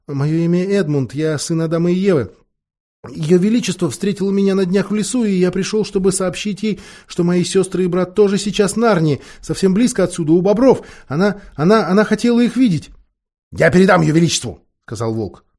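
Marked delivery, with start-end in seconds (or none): 0.0-12.9 put-on high, nervous boy's voice; scared boy pleading his case; frightened babbling. A narrator's reading. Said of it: "дрожа так, что едва мог шевелить губами". 13.5-14.6 gruff wolf. A narrator's reading. Wolf curtly answers the boy.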